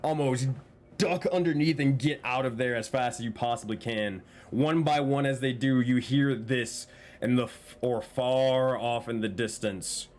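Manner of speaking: game master describing the scene; story narration. A narrator's reading. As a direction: narrate the action, drawing it out.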